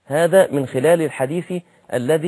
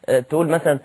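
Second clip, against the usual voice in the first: ordinary talk, nothing marked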